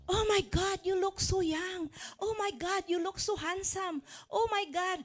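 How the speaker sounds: high, loud gushing compliments; amazed neighbor. Retold or imitated. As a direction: gush over them like a stunned neighbor. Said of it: "and your neighbor will say".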